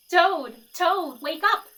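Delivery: loud, high wake-up call. Acted. Frog at Toad's bedside calling loudly to wake him. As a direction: shout him awake. Frog waking sleeping Toad.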